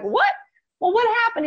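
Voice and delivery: shocked disbelief. Retold or imitated. Hypnotist recalls client's failed weight loss, shocked high disbelief. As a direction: relive the jolt of hearing bad news.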